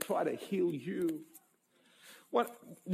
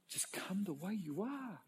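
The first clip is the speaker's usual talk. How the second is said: much softer, gentle welcome; tender invitation; 12 dB quieter than usual. Retold or imitated. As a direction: invite the broken gently, almost hushed. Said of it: "Whereas Jesus was".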